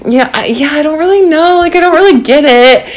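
airy put-on cluelessness; playing dumb. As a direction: act innocent so he won't suspect a thing. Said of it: "And just totally playing it up".

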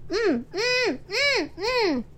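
high muffled hums, mouth taped shut; wordless muffled protest; muffled mumbling. A narrator's reading. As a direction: hum urgently through closed lips. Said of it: "He was trying to say".

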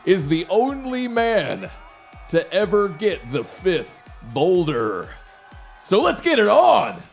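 high, loud, slow ring announcer. Acted. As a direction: hype the crowd like a showman. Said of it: "the announcer".

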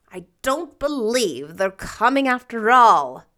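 loud, cross grumble. A narrator's reading. Marilla grumbles about the late guests, crossly. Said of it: "said Marilla crossly".

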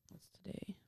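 whispered aside to her co-host; quick hushed question off-mic; whispering. Real, not acted. Asking quietly mid-recording.

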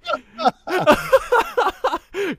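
delighted squealing laugh; real high-pitched laugh of delight; big high laugh. Real, not acted. Host delighted by Barrett singing idea.

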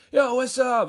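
high, loud imitation of a showy buddy; hollered greeting. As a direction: announce yourself to the whole shop. Imitates loud buddy entering shop.

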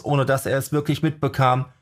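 ordinary delivery

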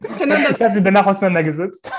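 laughing while telling it. Real, not acted. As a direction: laugh through the line. Laughing about the seating story.

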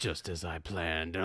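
low, slow, lightly voiced Batman; a hint of brooding Batman; slow lightly done Batman rasp; Batman impression. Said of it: "that was Batman".

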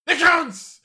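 high, loud outburst; triumphant shout. Acted. Revived man crying out the moment he wakes, shout. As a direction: yell it out high and sudden.

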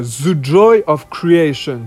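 put-on French speaker putting on English accent. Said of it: "toujours avec un accent anglais".

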